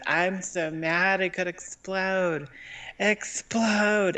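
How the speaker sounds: high, drawn-out imitation of a furious guy; mock rage. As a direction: act out a sketch character building to exploding. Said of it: "just started screaming until his head exploded".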